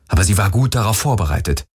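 ordinary delivery, nothing marked

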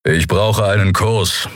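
slow grumbling request; a mild grumble; grumbled. A narrator's reading. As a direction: grumble it out, unwilling. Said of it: "brummte er".

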